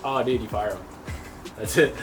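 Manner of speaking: normal talking voice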